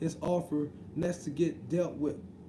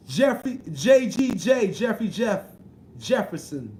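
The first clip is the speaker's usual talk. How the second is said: calling out a name; 5 dB louder, slower than usual. Real, not acted. Greeting viewer Jeffrey in chat with a loud call. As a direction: hail the viewer across the room.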